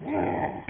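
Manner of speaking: low-volume imitated dog growl; mock growl, held back.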